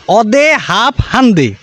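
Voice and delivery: slow, broad Barisal Bengali; অদে হাফ হান্দে in slow Barisal speech; Barisal dialect. Retold or imitated. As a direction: say it like a Barisal villager, slowly. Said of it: "বিখ্যাত বরিশালের ভাষায়".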